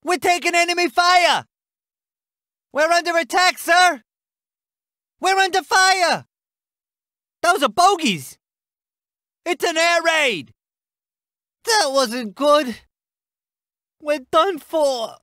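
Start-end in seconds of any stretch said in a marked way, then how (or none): 0.0-10.5 acted urgent report, pitched up; under-fire alarm. Reporting an attack to commander.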